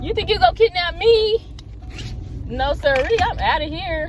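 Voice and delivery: loud, high indignant outburst; alarmed defiance. Real, not acted. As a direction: call out defiantly at a suspicious car. Alarmed by car stopping near her.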